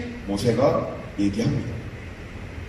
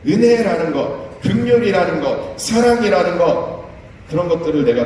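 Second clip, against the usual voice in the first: preaching at full force; 7 semitones higher, 3 dB louder than usual. Acted.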